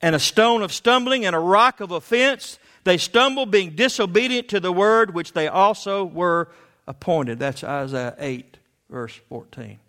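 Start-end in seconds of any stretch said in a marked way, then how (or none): 0.0-7.4 high, loud fervent preaching; preaching at full force. Acted. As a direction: thunder it from the pulpit.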